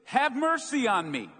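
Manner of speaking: loud, high cry for help; shouting. Acted. Blind beggar shouting to Jesus over the crowd, loud. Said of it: "So he began shouting".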